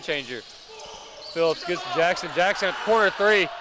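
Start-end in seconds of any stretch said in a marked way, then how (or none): 1.3-3.5 excited play-by-play. Real, not acted. Commentator calls a corner three, excitement surging. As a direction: call building higher and louder.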